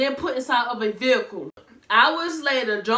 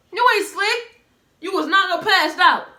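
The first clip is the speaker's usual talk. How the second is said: calling out; 6 semitones higher, 5 dB louder than usual. Real, not acted. Calls out friend's lie.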